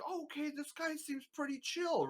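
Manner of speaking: high, light mock viewer voice; approving chat member. Community member sizing up a polite newcomer, high and approving. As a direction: pitch it up as an easily won-over viewer.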